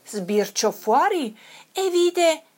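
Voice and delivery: ordinary delivery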